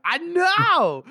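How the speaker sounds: put-on high, loud drunken interruption; drunk colonel. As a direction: cut in with a tipsy whoop. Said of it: "and he's, like, drunk".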